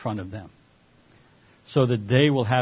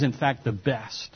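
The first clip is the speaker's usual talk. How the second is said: ordinary delivery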